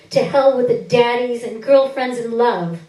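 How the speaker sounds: loud, defiant, spitting it out; defiant outburst. Acted. To the camera, rejecting daddies and lovers: loud defiant outcry. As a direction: fling the words at the lens in defiance.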